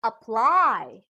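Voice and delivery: "Apply" with PLY stretched and stronger; "Apply" demonstrated with emphatic, rising-falling PLY; stress on second syllable. Shown as a demonstration. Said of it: "put the stress on the second syllable".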